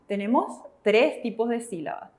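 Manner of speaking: usual speaking voice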